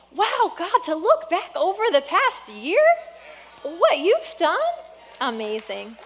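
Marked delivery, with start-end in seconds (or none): 0.1-4.6 higher, marvelling at what God did; awed wonder. Real, not acted. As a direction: breathe out wonder toward God. Looking back in awe.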